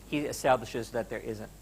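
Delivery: ordinary delivery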